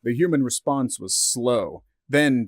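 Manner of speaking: ordinary delivery, nothing marked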